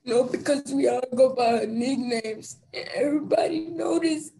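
ordinary talk

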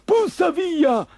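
high, drawn-out angry yell; menacing shout. Acted. Fisherman chasing off the dog, menacing shout. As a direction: shout the intruder away in a monster voice. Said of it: "gridò minaccioso il pescatore".

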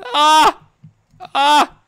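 high screaming; falling screams. Real, not acted.

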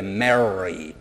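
"Mary" drawn out very slowly with a strong American R; marking the R. Shown as a demonstration. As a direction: stretch "Mary" and lean on the R. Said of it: "a veces marca más las R". ("Mary" despacio marcando la R)

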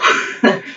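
short unvoiced chuckle; breathy laugh. Real, not acted. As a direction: laugh it off under your breath. Laughs after a bad move.

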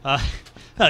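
ordinary talk

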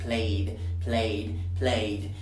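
"played" low, ending in voiced d; "played" drilled in one syllable with a d; final d, not t. Shown as a demonstration. Teacher saying repeat and repeat, low "played" with final d. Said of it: "Cuidado que no suene como T".

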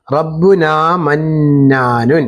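slow, even recitation of റബ്ബുന മന്നാനുൻ; teacher reads slowly for the student to repeat; slow model reading. Shown as a demonstration. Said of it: "ഞാൻ പറയുന്നത് പോലെ പറഞ്ഞാൽ ഉപകാരപ്പെടും".

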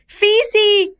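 put-on very high, loud child's scream. Girl on the wobbling ladder screams for help, high and loud. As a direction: shriek her name. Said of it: "gritó Mandy".

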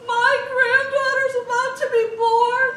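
high, loud wailing mom; distraught mom. Retold or imitated. Grandmother despairing over the Spanish-speaking grandchild, high laughing wail. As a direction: wail it like a mother on the verge of tears. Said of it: "And she's".

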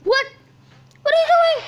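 high, loud disbelieving shout; shrill alarmed outburst; shocked shout. Real, not acted. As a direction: yell in shock at the screen. Shocked at screen.

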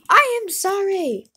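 high, loud, over-the-top sorry; loud apology. Real, not acted. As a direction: shout the apology back. Apologises after being yelled at.

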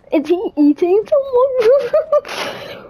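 high, slow, scared question; frightened. Real, not acted. Player spotting the monster below, high scared drawl. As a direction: voice lifted far up by dread. Creature lurking in horror map.

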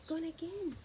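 breathy gasp then quick words; startled storyteller aside; mock dismay; gasp of surprise. Reader reacts to lost bow.

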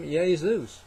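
"Jesus" said as YAY-zoos; Italian pronunciation. Shown as a demonstration. Preacher shows how Italy says the Savior's name, Italian pronunciation. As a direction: say "Jesus" with a Y start and a long oo. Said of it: "In Italy, they pronounce the Savior's name".

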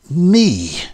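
loud, high, bitter exclamation; bitterly indignant outburst; bitter cry. A narrator's reading. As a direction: throw it back with terrible bitterness. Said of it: "bitter accent".

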